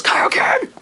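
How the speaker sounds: high, loud screamed Kaioken; Goku's battle cry. Retold or imitated. Vlogger imitating Goku powering up with a scream. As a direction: hurl it out like a power-up yell. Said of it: "and he goes".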